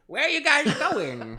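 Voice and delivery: shrill high cartoon voice, slow; high squeaky limo driver. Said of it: "and she's like".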